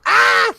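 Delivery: high startled goblin shriek; goblin scream. Acted. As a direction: shriek in sudden fright. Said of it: "and he goes".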